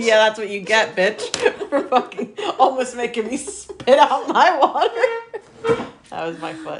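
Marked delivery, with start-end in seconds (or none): 0.9-5.9 loud, laughing mock scold, rising high at the end. Real, not acted. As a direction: tease her through giggles. Playful scolding while laughing.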